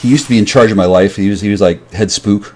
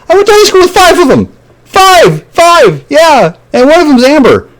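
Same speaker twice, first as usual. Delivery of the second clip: high-pitched, agitated yelling; worked-up outburst; 4 dB louder, slower than usual. Real, not acted. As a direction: burst out in a very high, insistent voice. Excited outburst about five classmates.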